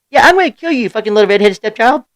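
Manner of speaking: loud, high imitation of a yelling kid; mock kid yell. Dad retelling his kid's outburst in Walmart, mock kid yell. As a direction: holler it like a kid copying dad.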